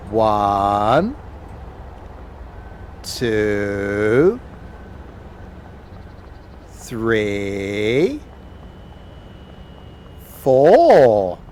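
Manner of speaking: put-on children's theatre actor. Counting train cars with viewers.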